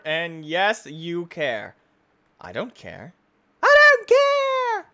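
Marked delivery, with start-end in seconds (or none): 2.4-3.1 low and soft, under the breath; muttered. Acted. Gamer muttering at the sky message, low and soft. Quiet take before scream. 3.6-4.9 high, slow mock scream. Gamer screaming back at the sky message, drawn out. Sudden scream after quiet line.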